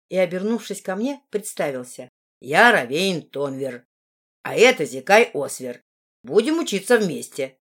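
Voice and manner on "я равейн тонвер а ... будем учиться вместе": lower, louder male character voice; half-dwarf friend. A narrator's reading. Half-dwarf telling his friend to stop, character voice. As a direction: tell a friend off in a deeper voice.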